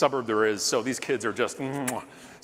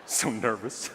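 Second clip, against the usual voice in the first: ordinary talk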